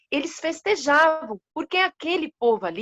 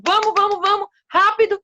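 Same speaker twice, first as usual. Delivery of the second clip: higher and louder, rushing them along; urgent hurry; 8 semitones higher, 4 dB louder, faster than usual. Acted. King hurrying the animals to the spring, loud and urgent.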